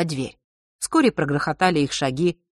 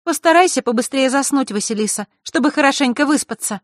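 put-on high, lively girlish voice; high-pitched, louder well-wishing; Zakharra, friendly advice; 9 semitones higher, 4 dB louder than usual. A narrator's reading. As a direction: send a friend to bed in a high voice. Zakharra's voice continues.

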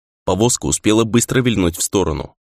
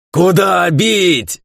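high, long-drawn furious shout; desperate fury; 9 semitones higher, 4 dB louder, much slower than usual. A narrator's reading. Kato spinning round for unseen foes, desperate furious shout. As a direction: stretch the words into a raging cry. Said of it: "воскликнул Като с отчаянной яростью".

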